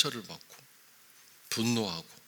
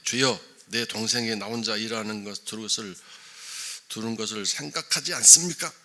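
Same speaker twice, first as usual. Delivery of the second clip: building from loud to louder indignant scolding; Martha's scolding complaint; 7 dB louder than usual. Retold or imitated. Martha scolds Jesus for not sending Mary to help, loud indignant complaint. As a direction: scold the teacher for letting her sister off. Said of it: "야단치고 있는 거죠".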